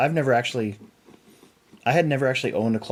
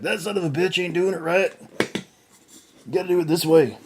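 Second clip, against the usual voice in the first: monster truck guy; 8 semitones higher than usual. Retold or imitated. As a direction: play a trucker correcting you. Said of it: "some monster truck guy that's gonna watch this and be like".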